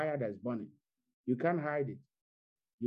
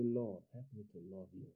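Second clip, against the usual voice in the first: soft prayer; 4 semitones lower, 9 dB quieter than usual. Acted. Quiet sample prayer.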